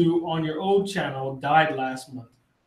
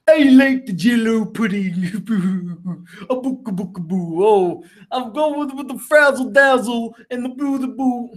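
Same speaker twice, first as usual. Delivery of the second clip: Bill Cosby impression; 4 semitones higher than usual. Answering a chat request, a hammed-up high Bill Cosby voice. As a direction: ham up a rambling comedian voice, pitched high. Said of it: "Bill Cosby impression".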